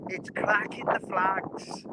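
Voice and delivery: slow, badly faked Liverpool accent; terrible Scouse accent. Streamer quoting the people from Liverpool to viewers, bad Scouse accent. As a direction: have a rough go at a Scouse accent. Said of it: "that is a terrible Scouser accent".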